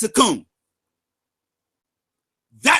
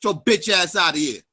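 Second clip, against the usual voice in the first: ordinary delivery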